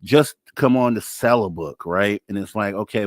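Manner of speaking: ordinary talk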